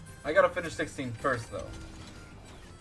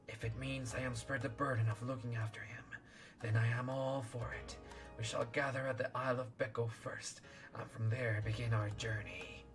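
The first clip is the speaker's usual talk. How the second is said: serpent voice; 9 dB quieter than usual. Acted. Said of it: "I'll do serpent voice then".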